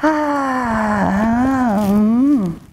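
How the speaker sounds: long falling "ah" into a hum; restless sighing moan. Acted. Princess trying to sleep on the bed, drawn-out moan. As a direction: moan it out, slowly sinking. Princess tries to get comfortable.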